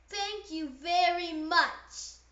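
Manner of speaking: gushing thanks. Acted. Pigeon thanking the duckling, slow and gushing. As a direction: savour every word of thanks.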